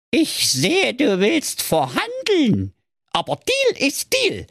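very high, slow dwarf voice. Acted. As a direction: squeak it out like a tiny villain. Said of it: "sagte der Zwerg".

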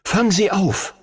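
high, urgent cry; urgent call. A narrator's reading. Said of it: "rief er".

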